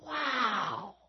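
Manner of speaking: soft, high, awestruck wow; wordless awe. Shown as a demonstration. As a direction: let the feeling carry the word. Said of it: "we could do it through our emotion".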